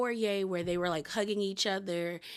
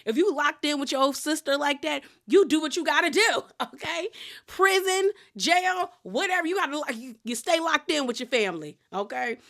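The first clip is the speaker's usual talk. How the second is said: high and loud, all in on it; hyped-up duh, of course; emphatic agreement; 8 semitones higher, 6 dB louder than usual. Retold or imitated. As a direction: back the sister up like it's obvious. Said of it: "And I said".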